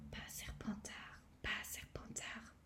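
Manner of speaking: breathless, pleading whisper; desperate whispered thought; fierce inner whisper. Acted. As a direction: beg silently with all his might. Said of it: "pensa-t-il avec force".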